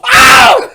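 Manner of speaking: very high, loud yelp; piercing hammed-up holler; reenacted excited scream. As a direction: let out an overblown fan scream. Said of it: "I go".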